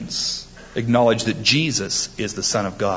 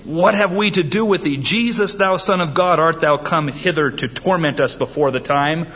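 crying demons; 6 semitones higher than usual. Acted. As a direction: shriek it like cornered demons. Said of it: "they cried out".

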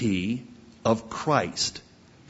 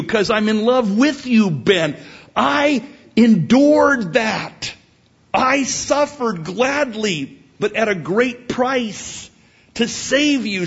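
outraged, wounded father; 10 semitones higher, 6 dB louder than usual. Pretend. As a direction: play a dad pleading in indignation. Acted father outraged at son's denial.

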